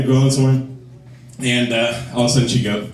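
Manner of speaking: ordinary talk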